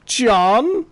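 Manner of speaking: acted shock at spotting someone; surprised recognition. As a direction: call out a name in disbelief. Acquaintance recognises him, acted out.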